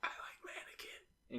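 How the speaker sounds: soft unvoiced whisper. Real, not acted. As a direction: whisper it like a confession. Teasing whisper aside.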